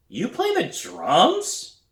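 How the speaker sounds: high, slow disbelieving question; incredulous brother. A narrator's reading.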